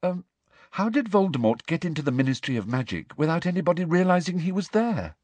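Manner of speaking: high, anxious questioning; rather frightened. A narrator's reading. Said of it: "in a rather frightened voice".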